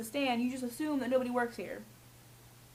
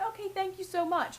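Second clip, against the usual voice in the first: polite thanks; 7 semitones higher than usual. Retold or imitated. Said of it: "I'd say".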